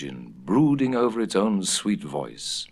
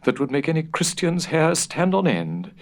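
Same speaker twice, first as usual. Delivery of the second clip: put-on higher, intense old housekeeper; stern warning; 7 semitones higher than usual. A narrator's reading. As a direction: warn of horrors gravely. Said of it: "she sternly warned".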